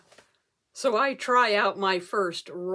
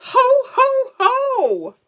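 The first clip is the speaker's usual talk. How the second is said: big, booming, high ho ho ho; jolly Santa laugh; 9 dB louder, much slower than usual. Acted. Speaker revealing her famous ancestor, hammed-up Santa laugh. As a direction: belt out a hammed-up Santa chuckle.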